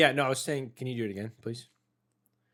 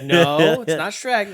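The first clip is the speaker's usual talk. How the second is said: laughing correction; 5 semitones higher, 11 dB louder, slower than usual. Retold or imitated. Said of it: "It's like".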